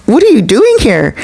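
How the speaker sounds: high-pitched startled name, then a baffled question; retold stunned disbelief; shocked. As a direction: mimic being caught completely off guard. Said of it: "he's in shock".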